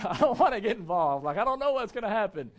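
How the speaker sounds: loud, hammed-up protest; mock reluctance. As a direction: play a nervous introvert refusing to join in. Acts reluctant introverts resisting getting involved.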